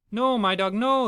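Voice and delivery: high, urgent cry of no; child crying out to stop the dogs fighting. Acted. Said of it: "the child cried out".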